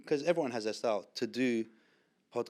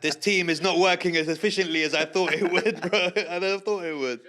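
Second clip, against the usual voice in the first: loud, higher, cracking up; laughing; 5 semitones higher, 6 dB louder than usual. Real, not acted. Guest cracks up over his team not working, loud laughing. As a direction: complain through your laughter. Cracks up after the failed stunt.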